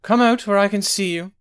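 loud, high command into the shadows. Acted. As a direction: order him out with full authority. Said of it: "she commanded".

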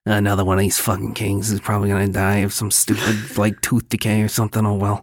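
low, fast world-weary priestess; jaded sacred priestess. Acted. Priestess fed up with dying kings, low jaded voice. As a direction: grumble it low and resigned, rattled off. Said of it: "That voice made me think of".

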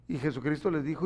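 ordinary talk, nothing marked

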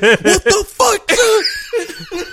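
very high, slow outraged whine; mock indignant squeal. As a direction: squeal it in disbelief. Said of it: "She's like".

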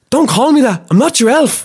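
high, loud indignant snap; the elf yelling at Santa not to call him elf; angry outburst. Acted. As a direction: shout it back, offended. Elf angrily objecting to the name.

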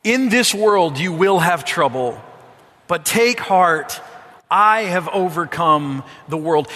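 loud, slow recitation to lead the congregation; loud, slow group reading. Shown as a demonstration. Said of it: "Read these words with me now, out loud".

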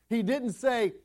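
usual speaking voice

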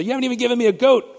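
mock a spoiled child whining "You haven't even given me a goat"; whiny kid voice. Shown as a demonstration. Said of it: "just say".

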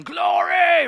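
long, high, loud holler; imitated worship yell. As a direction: yell it out like an exuberant worshipper, stretching it. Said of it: "hear him yelling".

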